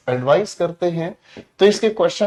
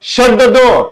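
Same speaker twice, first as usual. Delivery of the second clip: loud, sharp order, pitched up; commanding order; 6 semitones higher, 11 dB louder, much slower than usual. Shown as a demonstration. Teacher reading the sentence aloud as a firm order. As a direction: bark it as a command. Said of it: "मैंने इस sentence को पढ़ा".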